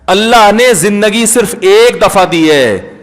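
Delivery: usual speaking voice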